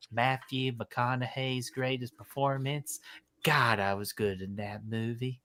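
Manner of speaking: soft, hammed-up Southern movie-star accent; Matthew McConaughey. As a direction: do a bad, hushed McConaughey accent. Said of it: "McConaughey, um, accent".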